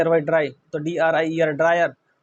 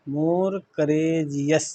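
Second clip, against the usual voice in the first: very slow, stretched "more courageous"; drawn-out teaching repeat of "more courageous"; syllable-by-syllable; much slower than usual. Shown as a demonstration. Teacher breaks the word up slowly.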